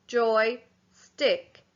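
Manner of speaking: "Joy," and "stick." said as two clear separate beats; split into syllables. Shown as a demonstration. Teacher splitting joystick into syllables for pupils. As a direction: clap out Joy, stick for a class. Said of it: "There's two syllables there".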